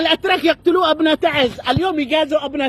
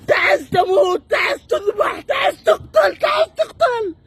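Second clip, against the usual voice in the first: anguished shout; 4 semitones higher, slower than usual. Real, not acted. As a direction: cry out for the city. Protester crying out for Taiz.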